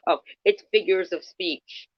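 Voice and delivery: lightly mocking whine; mocking quote. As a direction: mimic her dismissive excuse. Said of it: "she gets to run around".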